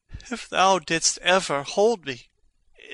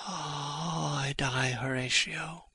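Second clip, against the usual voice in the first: softer, fading dying gasp; faint deathbed whisper; 10 dB quieter than usual. Acted. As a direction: breathe out the last words. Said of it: "This potent poison quite outgrows my spirit".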